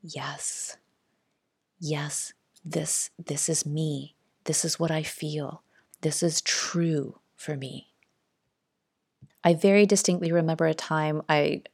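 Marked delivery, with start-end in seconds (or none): 0.0-7.9 soft, slow inner voice; soul whispering. Acted. The soul speaking to you inwardly, softly whispering. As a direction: hush it, like a quiet inner knowing. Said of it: "what the soul is whispering to you".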